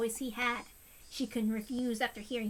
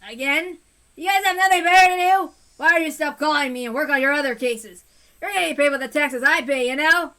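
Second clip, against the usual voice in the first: angry yelling; 3 semitones higher, 13 dB louder than usual. Acted. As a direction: bark at the caller to leave you alone. Teppei yells at caller.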